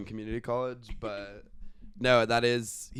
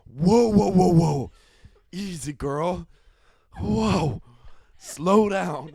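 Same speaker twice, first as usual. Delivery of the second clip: higher, louder whoa like calming a horse; soothing a horse; 7 semitones higher, 8 dB louder, much slower than usual. Retold or imitated. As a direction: rein in a horse, loud and high. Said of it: "and she's like".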